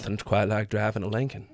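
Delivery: lightly done laid-back Texan ad voice; a hint of a Texan ad narrator; Matthew McConaughey. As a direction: ease into a smooth car-commercial read with a Texas accent. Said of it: "is Matthew McConaughey".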